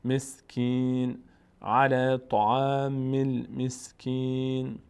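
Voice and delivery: المسکین drawn out slowly, ending on a vowelless n; verse-ending recitation. Shown as a demonstration. Teacher modelling the stop at verse end, slow recitation. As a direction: recite syllable by syllable, long ee in المسکین.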